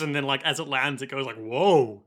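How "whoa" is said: low-pitched man's exclamation; low startled man. Acted. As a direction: drop low, like a bird with a man's voice. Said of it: "in a man voice".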